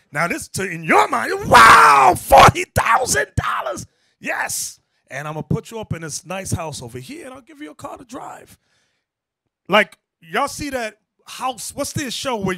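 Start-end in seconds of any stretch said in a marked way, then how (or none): 1.5-8.6 loud, high, thrilled gasp at the money; amazed. Retold or imitated. Imitating a dazzled worker hearing the offer, loud and thrilled. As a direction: sell the shock of a big payday. Voicing an amazed job recipient.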